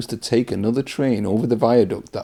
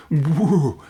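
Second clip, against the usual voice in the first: ghostly woo sound; spooky woo; 6 semitones higher than usual. Acted. Host hamming a ghost for the kids, spooky woo. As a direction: hoot like a cartoon ghost.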